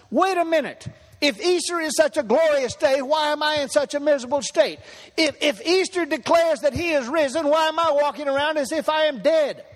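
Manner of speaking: preaching at full force. Acted. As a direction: hurl the questions at the congregation like a revival preacher.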